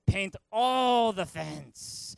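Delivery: very slow, loud mock order; drawn-out karate-master command; Mister Miyagi. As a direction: stretch it out for emphasis like a demanding master.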